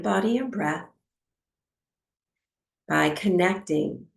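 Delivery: calm, unhurried meditation guide with long pauses; guided meditation. Acted. Teacher settling a class into breathwork, calm guided meditation. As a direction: ease them into stillness, phrase by phrase.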